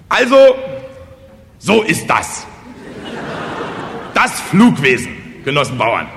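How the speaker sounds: loud, halting speech with high emphatic peaks; fumbling village orator. Acted. Kosonossow agitates the peasants at the fire shed, halting oratory. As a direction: address a crowd while groping for the next phrase.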